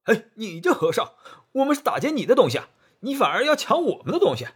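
high, loud, fast indignant yelling; furious bandits. Acted. Robbers yelling angrily at the monk who mocked them. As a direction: shout in outraged disbelief. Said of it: "大怒啊".

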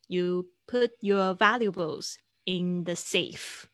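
You put your valuables in the safe, read out very slowly; slow example read. Shown as a demonstration. Teacher reading an example sentence slowly to the class. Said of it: "example sentence read slowly".

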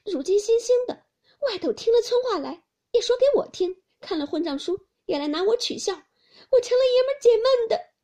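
high, loud wounded wail; crying in hurt indignation; tearful outburst. A narrator's reading. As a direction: protest bitterly through tears. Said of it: "黛玉便哭道".

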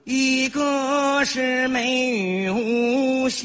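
very slow, drawn-out recital; slow emotional poem reading. Pretend. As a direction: recite it slowly with feeling. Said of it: "你唱让我听听".

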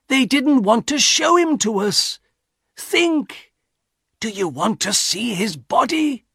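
high-pitched, desperate reasoning; horrified appeal. A narrator's reading. Husband warns wife about son's mangled body, desperate appeal. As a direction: plead with her to see the horror.

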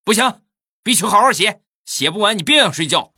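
loud, high-pitched mock scolding; stern mother shouting. Mother storming in on homework protest, loud stern scolding. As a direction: lay down the law as mom. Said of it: "他妈立马就进来了".

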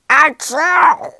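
loud acted sneeze; explosive, voiced "achoo". A narrator's reading. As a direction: let out a big storybook sneeze. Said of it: "sucked up some fire water and sneezed".

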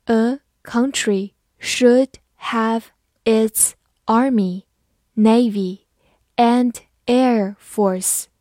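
very slow, word-by-word delivery; slow, measured teacher's read-out. Shown as a demonstration. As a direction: pause after each word as for learners. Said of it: "好，慢慢来读".

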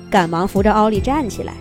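ordinary delivery, nothing marked

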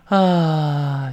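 long loose sigh; relaxed sigh. Shown as a demonstration. Teacher demonstrating an easy outward breath. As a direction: breathe out all tension. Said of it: "就类似这么一口气".

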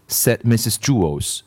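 ordinary delivery, nothing marked

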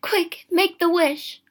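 high, loud, slow emphatic command; urgent cry. A narrator's reading. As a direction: cry out to force his hand. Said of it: "and cried".

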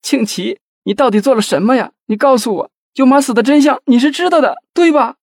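very high, loud distraught wife; high-pitched hysterical pleading; wailing tearfully. A narrator's reading. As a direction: cry out and demand the truth. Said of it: "抱住费庆奇大哭".

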